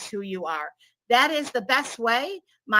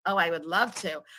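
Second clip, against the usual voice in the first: ordinary delivery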